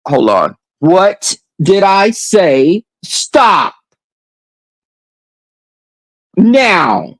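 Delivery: stern, clipped order to the pet; firm command to dog. Real, not acted. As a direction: tell the dog off firmly.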